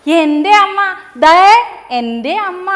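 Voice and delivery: high and loud, the chick shouting for joy; calling out excitedly. Acted. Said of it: "വിളിച്ചു കൂവാൻ തുടങ്ങി".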